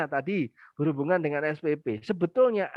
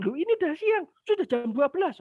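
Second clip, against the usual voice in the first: high, raised mock scolding; 10 semitones higher than usual. Mother scolding her child for not bathing, high and sharp. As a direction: snap back at the child, pitched up. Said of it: "anaknya diomelin lagi".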